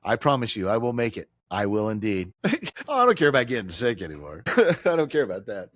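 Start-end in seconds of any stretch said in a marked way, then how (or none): 2.4-5.7 chuckling between phrases; amused, chuckling protest; laughing banter. Real, not acted. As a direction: laugh off the worry. Laughing banter with traffic reporter.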